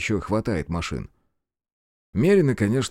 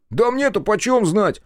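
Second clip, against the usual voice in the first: high, slow, baffled reply; high-pitched puzzled sidekick; surprised; 12 semitones higher, 4 dB louder than usual. A narrator's reading. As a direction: protest that you have no idea. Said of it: "удивлённо уставился на меня он".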